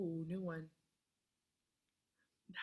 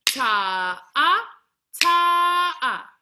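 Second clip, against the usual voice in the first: louder ta then stretched ah; held ta-ah; 5 semitones higher, 9 dB louder, much slower than usual. Shown as a demonstration. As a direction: chant the long note. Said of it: "Try that with me".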